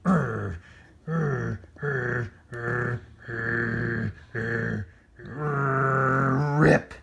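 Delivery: loud effortful grunts and tug; straining grunts. A narrator's reading. Oscar tugging the costume, straining grunts. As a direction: strain with all your might. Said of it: "chomped and tugged with all his might".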